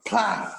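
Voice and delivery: high, sudden splat noise; imitated suction slap. Co-host telling of sticking it to the door, high splat. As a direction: pop out a high smacking sound effect.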